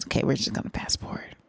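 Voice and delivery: quick whisper to herself. Real, not acted. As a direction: whisper it fast, aside. Skipping the track quietly.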